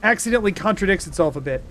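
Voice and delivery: ordinary talk, nothing marked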